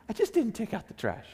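small, deflated protest; meek defense. Retold or imitated. The accused spouse answering, meek and deflated. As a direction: give up and mumble your excuse. Meek reply in family fight.

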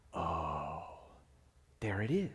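low, soft, dawning realization; hushed awe. Retold or imitated. Before the painting at the gallery, hushed awe. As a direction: breathe it out as it finally clicks.

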